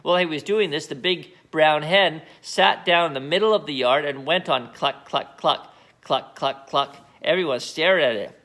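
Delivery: usual speaking voice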